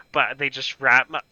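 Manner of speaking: ordinary talk, nothing marked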